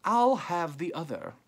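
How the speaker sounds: high, aristocratic call. A narrator's reading. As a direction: claim it like an aristocrat calling across. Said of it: "came an aristocratic voice".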